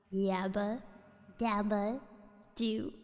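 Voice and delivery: slow, eerie catchphrase; ghostly low voice. Acted. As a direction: draw it out like a ghost.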